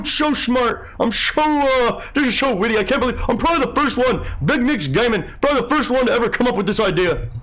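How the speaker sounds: high, mocking voice of a smug chat troll. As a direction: sneer it like a know-it-all troll who thinks he's a genius. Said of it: "what I imagine them thinking".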